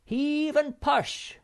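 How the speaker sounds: high, loud, slow drawn-out heave cry; long hauling shout. A narrator's reading. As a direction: stretch it out as you shove. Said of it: "shouted Snufkin".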